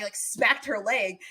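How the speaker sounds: ordinary talk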